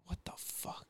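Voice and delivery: retold stunned whisper; whispered disbelief. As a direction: whisper it, barely voiced. Retells stunned reaction to staring.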